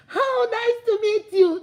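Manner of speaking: high, slow gushing greeting; overfamiliar greeting. Acted. Woman hugging someone's husband too closely, high and gushing. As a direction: coo it high and drawn out. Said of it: "They will use their boobs".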